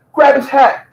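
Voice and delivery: high urgent mock egging-on; lightly voiced girlfriend pushing her man; mocking Phoenix. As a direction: squeal it like she's urging him on. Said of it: "she told Lamar".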